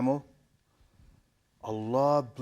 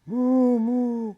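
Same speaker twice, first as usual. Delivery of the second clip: high, loud playful mooing; cow moo; 13 semitones higher, 9 dB louder than usual. Pretend. Imitating a cow.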